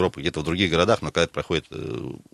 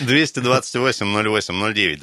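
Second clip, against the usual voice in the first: announcer read; 4 semitones higher, 3 dB louder than usual. Acted. Radio host reads phone number.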